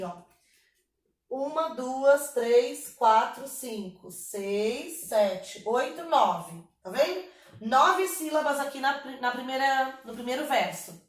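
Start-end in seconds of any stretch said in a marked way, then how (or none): 1.3-6.6 slow, evenly spaced count. Shown as a demonstration. Teacher counting the verse's syllables for students, measured counting. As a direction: count each beat separately, drawing the words out. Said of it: "vamos contar aqui".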